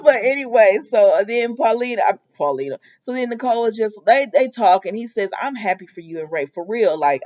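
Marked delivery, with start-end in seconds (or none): none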